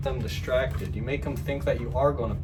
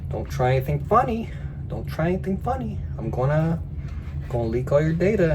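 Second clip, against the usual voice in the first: mock threat; 5 semitones higher, 5 dB louder than usual. Scammer threatening.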